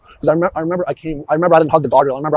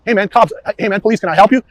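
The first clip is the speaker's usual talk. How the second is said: reenacted call-out; 6 semitones higher, 5 dB louder than usual. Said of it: "I want the volume of your voice to be the same".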